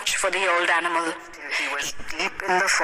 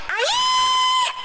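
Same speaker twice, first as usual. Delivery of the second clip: very high, drawn-out yell; long high-pitched fighting shout; shouted battle cry; 4 dB louder than usual. Acted. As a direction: let out a piercing child's war cry. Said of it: "shout, 'Aye!'".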